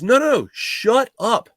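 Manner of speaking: high, slow mock scold; retold exasperated shut up, pitched high; irritated boss. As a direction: cut him off like a fed-up boss. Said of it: "He goes".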